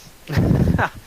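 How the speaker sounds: loud breathy laugh; burst of laughter. Real, not acted. GM laughs at player's bit.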